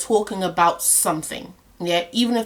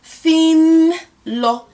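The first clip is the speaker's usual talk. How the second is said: slow demo; 4 semitones higher, much slower than usual. Shown as a demonstration. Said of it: "I would say".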